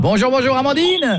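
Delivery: high, enthusiastic radio-host hello; cheery greeting. Acted. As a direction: greet the listener with big cheer. Cheerful redo after creepy call. (bonjour aigu, très enjoué)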